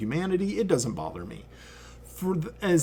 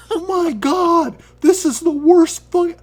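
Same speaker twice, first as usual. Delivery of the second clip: high, loud, laughing panic; half-laughing squeal of horror; freaking out; 9 dB louder than usual. Retold or imitated. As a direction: shriek it out in mock horror. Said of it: "I am fucking freaking out".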